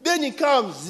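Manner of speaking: usual speaking voice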